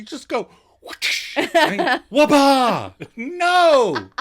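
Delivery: high, loud wapa and no; excited replay. Shown as a demonstration. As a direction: replay the bit with whoosh and wapa. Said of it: "It's really".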